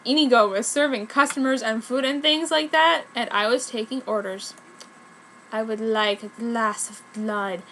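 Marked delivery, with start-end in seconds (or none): 5.5-7.6 slow, low vampire order; lightly voiced vampire asking for blood; vampire customer. A narrator's reading. Vampire customer orders blood, slow and low. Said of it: "said this one guy".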